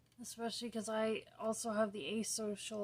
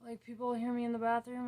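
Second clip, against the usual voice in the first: usual speaking voice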